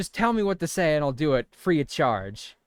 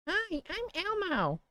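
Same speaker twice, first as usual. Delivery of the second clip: slow, very high puppet voice; softer drawn-out falsetto puppet greeting; Elmo; 5 dB quieter, slower than usual. Shown as a demonstration. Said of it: "You wanna hear my Elmo impression".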